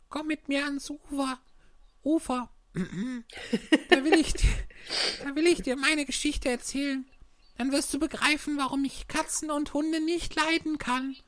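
high mouse voice, a touch of tremble; a shaken little mouse asking her ashore; trembling mouse. Acted. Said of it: "mit leiser, zitternder Stimme".

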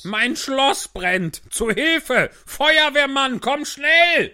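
very high, loud, slow cries for help; panicked king yelling. Acted. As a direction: scream for help as a frantic king. King calls for help in fire.

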